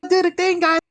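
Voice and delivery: very high mock boasting; mocking imitation. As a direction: imitate their silly pride in a squeaky-high voice. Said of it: "you just kinda stood there like".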